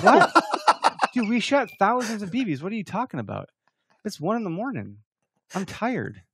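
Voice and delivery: acted-out outraged disbelief; upset, incredulous. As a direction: retell it as an indignant, worn-out complaint. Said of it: "I was so upset".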